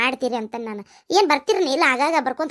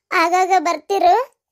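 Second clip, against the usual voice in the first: slow, louder tearful pleading; tearful plea; 5 dB louder, much slower than usual. Acted. Bride asking brother to visit often, tearful plea. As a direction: beg him slowly through tears.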